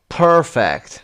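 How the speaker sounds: perfect with PER loud, falling after; stress on first syllable. Shown as a demonstration. Teacher working through the exercise, first-syllable stress on perfect. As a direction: punch the start of perfect. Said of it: "first syllable".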